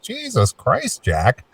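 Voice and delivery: slow, high, drawn-out disbelief; amazed exclamation. Retold or imitated. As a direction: stretch it out in awe. Mock reaction to Jack singing.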